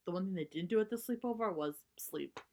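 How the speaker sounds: ordinary delivery, nothing marked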